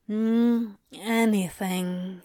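slow, sleepy, sighing reply; dreamy sigh. A narrator's reading. Demon answers the angel, dreamy and content. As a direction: drift the word out half asleep. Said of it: "Anthony sighed dreamily".

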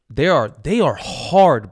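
slow, admiring, leaning on hard; hyped-up praise. Real, not acted. Host hyping the Texans receivers, slow admiring swagger. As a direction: savor it like it's the coolest thing.

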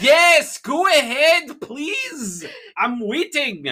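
high-pitched, exasperated go-ahead; testy impatience. Acted. Interviewer yielding to a difficult guest, testy and impatient.

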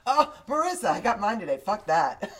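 loud and high, laughing; loud laughing exclamation. Real, not acted. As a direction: call it out, laughing. Loud excited announcement.

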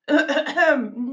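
small polite throat clear. A narrator's reading. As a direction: clear your throat before correcting someone. Narrator clears throat before correcting. (beleefd de keel schrapen)

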